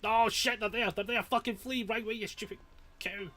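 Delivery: high, loud alarmed yelling; panicked yell. Real, not acted. Gamer yells at teammate in real panic. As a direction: sound the alarm in real fright. Killer suddenly near teammates.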